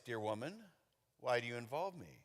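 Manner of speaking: understated, softly curious; gentler reading. Shown as a demonstration. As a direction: ease it out quietly and low. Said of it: "you could say it like this".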